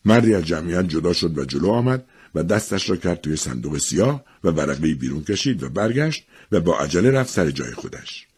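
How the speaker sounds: usual speaking voice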